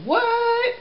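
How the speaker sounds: a squeaky, very high cartoon "What?"; mom's favourite Minion squeal. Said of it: "one of the Minions goes".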